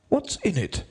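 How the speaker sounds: very high-pitched demand; queen. A narrator's reading. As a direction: ask as the queen, pitched right up. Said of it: "said the queen".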